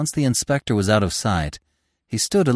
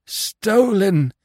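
a stunned, higher moan; 10 semitones higher than usual. A narrator's reading. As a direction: moan it out in shock. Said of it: "he moaned".

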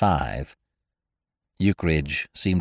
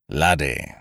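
solemn; 5 dB louder than usual. A narrator's reading. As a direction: weigh the word down solemnly. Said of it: "said Ukridge solemnly".

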